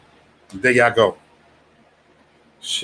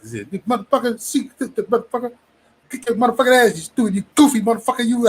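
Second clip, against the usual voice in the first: high-pitched mock rant, much higher voice; imitating a passionate friend; 9 semitones higher than usual. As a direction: ham up a hot-headed buddy losing it. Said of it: "A D be all passionate".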